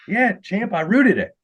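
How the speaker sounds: cocky Australian mate; Aussie accent. Retold or imitated. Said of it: "pretty good, uh, Aussie accent".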